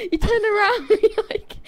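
laughing hard while retelling; laughing shout. Real, not acted. Friend laughing at the ghost scream, loud. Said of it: "What was that laugh?".

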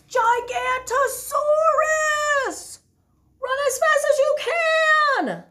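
very high, loud drawn-out yell; desperate cry. Acted. Bonehead crying out one last alarm, shouting. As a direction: scream the alarm. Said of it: "the cry began".

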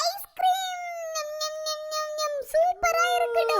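thrilled little voice over a treat; delighted kid. Acted. Child digs into the ice cream, high delighted munching.

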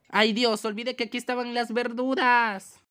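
high, panicky outcry stretched at the end; alarmed cry. Pretend. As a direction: cry out on remembering the danger. Vegetables attack again.

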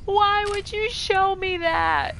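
high, loud, slow wail of disbelief; shocked outcry. Real, not acted. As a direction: cry out, stunned and thrilled. Reacting to sequel reveal.

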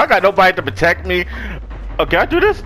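ordinary talk, nothing marked

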